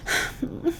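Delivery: long breathy sigh of relief; exhausted unvoiced sigh; weary sigh. Real, not acted. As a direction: let out a tired sigh. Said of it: "we're getting there, guys".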